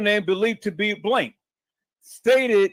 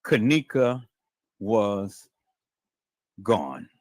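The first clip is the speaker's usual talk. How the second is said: very low, word by word; grave reveal; 11 semitones lower, slower than usual. Real, not acted. Host answering his own question for the audience, grave and deliberate. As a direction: drop deep with long pauses between words.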